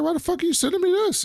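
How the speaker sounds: high-pitched, rapid retold outrage; mock indignation. Podcaster acting out Yaz confronting her lawyer over an email, indignant.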